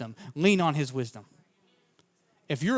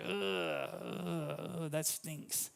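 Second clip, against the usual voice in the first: much softer, deflated ugh; disappointed groan; 15 dB quieter, much slower than usual. Retold or imitated. Dad hearing his son gave up fishing, soft deflated groan. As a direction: let the air out, crestfallen.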